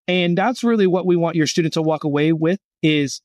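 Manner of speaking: ordinary delivery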